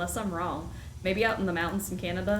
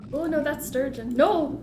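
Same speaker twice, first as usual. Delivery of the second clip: dismayed cry; 7 semitones higher, 6 dB louder than usual. Real, not acted. Wrong fish caught.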